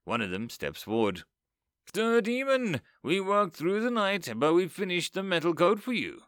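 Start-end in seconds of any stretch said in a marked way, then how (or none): 2.0-6.2 lightly voiced proud craftsman, a bit higher; a hint of a proud armorer presenting his work. A narrator's reading. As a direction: announce it with pride. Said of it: "he says proudly".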